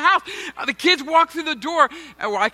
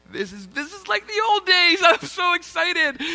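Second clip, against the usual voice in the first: mock giddy excitement; 6 semitones higher than usual. Pastor retelling his wife's dinner text, high giddy gush. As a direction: gush like a kid promised a treat. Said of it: "And it was like".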